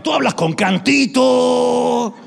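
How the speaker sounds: exaggerated Mexican singsong, loud and stretched out; mock Mexican accent. Mexicans teasing the preacher about a singsong, mock Mexican accent. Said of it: "Me dicen los mexicanos".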